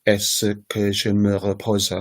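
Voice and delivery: "Est-ce que je me repose" slowly separated into its pieces; word by word. Shown as a demonstration. Teacher showing French has many words, "Est-ce que je me repose" slowly separated. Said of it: "has about eight words in it".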